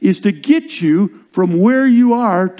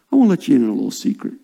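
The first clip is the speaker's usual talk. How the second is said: usual speaking voice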